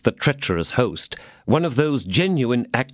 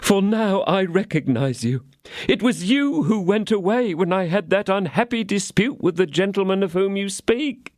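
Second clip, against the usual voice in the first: pleading innkeeper; 11 semitones higher than usual. A narrator's reading. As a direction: beg to be heard. Innkeeper explains himself.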